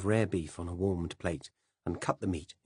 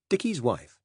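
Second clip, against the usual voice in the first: ordinary delivery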